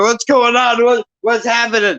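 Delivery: high-pitched, agitated mock shout; mock yelling. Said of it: "hear me yelling and screaming".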